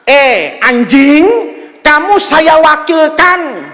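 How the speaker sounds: slow, drawn-out address to a dog; formal appointment, stretched out slowly; slow proclamation. Shown as a demonstration. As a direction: proclaim it word by word, stretching the endings. Demonstrates solemn appointment of the dog.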